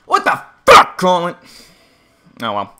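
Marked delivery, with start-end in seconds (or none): 0.1-1.4 very high, loud, incredulous shout; exasperated yell. Real, not acted. Gamer to Colin in the game, exasperated yell. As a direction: scream it at the character.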